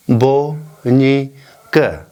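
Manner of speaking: बो, नी said very slowly, split apart; syllable by syllable. Shown as a demonstration. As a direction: break the word into syllables. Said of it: "ये जो चार syllable".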